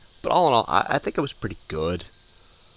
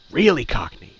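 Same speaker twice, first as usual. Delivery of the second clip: "Really" said higher and louder, then falling; emphatic repeat; 7 semitones higher, 7 dB louder than usual. Real, not acted. Repeating the phrase, now emphatic.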